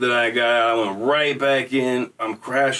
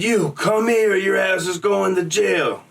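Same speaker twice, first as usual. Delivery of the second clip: high, slower guard threat; guard yelling; 7 semitones higher, slower than usual. Retold or imitated. Guard yells at the fleeing inmate over the intercom, high yelling threat. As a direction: yell like a guard losing patience.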